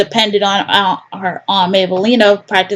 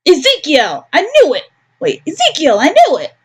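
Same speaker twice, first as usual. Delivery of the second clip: high excited gotcha; triumphant discovery; 8 semitones higher than usual. Acted. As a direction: catch him red-handed. Character catches Ezekiel.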